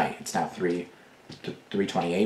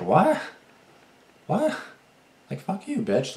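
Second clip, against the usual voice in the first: higher, outraged double-take; shocked outrage; 10 semitones higher, slower than usual. Retold or imitated. Replying to the date's insult, a much higher, shocked outburst. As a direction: react like you can't believe they said it.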